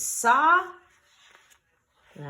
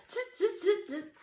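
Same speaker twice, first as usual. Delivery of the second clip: monkey chatter; 12 semitones higher than usual. A narrator's reading. Monkeys mocking back.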